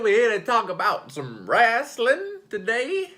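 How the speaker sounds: booming, slow announcer intro; wrestling announcer. Acted. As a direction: welcome the crowd like a ring announcer. Hyped show intro.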